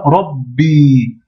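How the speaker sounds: "Rabbi" blocked on the b, then a long i; doubled consonant. Shown as a demonstration. Teacher demonstrating the shedda, "Rabbi" with doubled b, long i. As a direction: stop on "Rab", then release a held "bi". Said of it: "Mais il y a une shedda".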